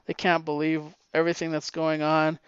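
usual speaking voice

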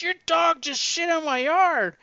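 high, loud angry complaint; acted-out indignant yell; pissed off. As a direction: yell it after someone down the street. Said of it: "and she gets pissed".

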